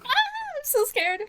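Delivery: high squeaky mock fright; hammed-up fearful squeak; mock scared. Mock reply to message.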